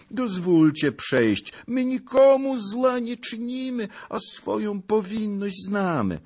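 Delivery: high-pitched, anxious protest; pleading old peasant. A narrator's reading. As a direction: plead innocence to an angry lord. Frightened old peasant protests, begs. (błagalny lament starca)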